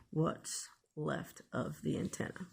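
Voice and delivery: ordinary talk, nothing marked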